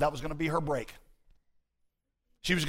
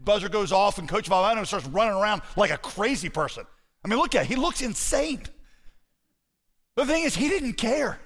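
impassioned preaching; 6 semitones higher, 4 dB louder than usual. Acted. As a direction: voice climbing high in amazement. Preacher at rising force.

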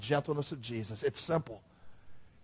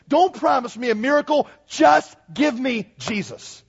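high, loud, fervent pulpit build; preaching at full force; 10 semitones higher, 7 dB louder than usual. Acted. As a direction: pound each demand home.

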